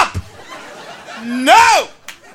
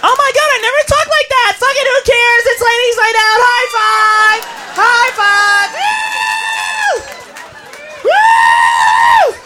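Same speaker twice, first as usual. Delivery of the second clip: put-on drunk party girls; 6 semitones higher, slower than usual. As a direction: whoop it up with the girls.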